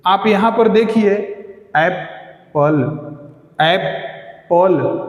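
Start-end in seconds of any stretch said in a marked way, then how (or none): 1.8-3.1 Apple split slowly as ऐप, पल; slow teacher's demo of Apple, pause between syllables. Shown as a demonstration. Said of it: "इसमें कितने". 3.6-5.0 Apple split slowly as ऐप, पल; slow teacher's demo of Apple, pause between syllables. Shown as a demonstration. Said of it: "दो स्वर ध्वनियाँ हैं".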